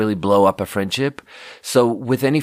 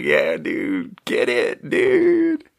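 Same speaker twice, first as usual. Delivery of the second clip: hushed, goofy egging-on; half-whispered mock cheer from the sidelines; Corey; much slower than usual. Coworker egging you on from the corner, hushed cheer. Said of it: "Corey's just looking at me from the corner".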